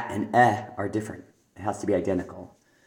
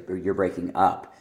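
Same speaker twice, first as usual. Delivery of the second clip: UP drawn out at the end of breaking up; slow phrase landing on UP; stress on UP; slower than usual. Shown as a demonstration. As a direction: lean on the word up. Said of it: "you put a stress on the up part".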